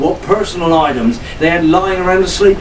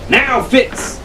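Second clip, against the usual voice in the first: a higher, lightly voiced yell; mock yell; 8 semitones higher than usual. A narrator's reading. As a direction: call out to Fitz, narrated not full. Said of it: "he yelled".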